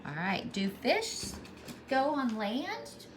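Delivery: plain reading voice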